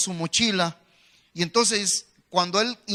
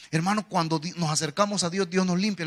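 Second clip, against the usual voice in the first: ordinary talk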